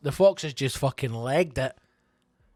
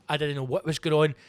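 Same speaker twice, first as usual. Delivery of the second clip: normal talking voice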